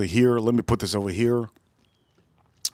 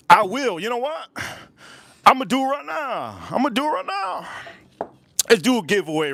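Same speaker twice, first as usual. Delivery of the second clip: hyped up excited; 9 semitones higher than usual. Real, not acted. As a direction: build the hype higher and higher. Hyping giveaway rising excitement.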